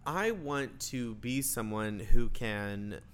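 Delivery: usual speaking voice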